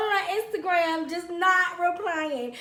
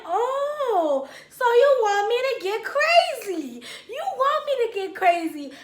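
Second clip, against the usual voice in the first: high, loud challenge; mock threatening; 8 semitones higher, 5 dB louder, slower than usual. Woman riled by boyfriend, loud threatening challenge. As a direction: wind yourself up for a fight.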